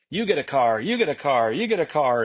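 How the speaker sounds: hyped giveaway announcer, pitch swooping on each phrase; Oprah Winfrey. Retold or imitated. Founder recalling the playful announcement, talk-show giveaway style. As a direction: call out each prize like a talk-show host. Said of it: "it was a little Oprah Winfrey-esque".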